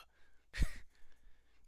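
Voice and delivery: quick high burst of laughter at himself; short laugh. Real, not acted. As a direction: laugh off the slip, lightly. Laughs at own mistake.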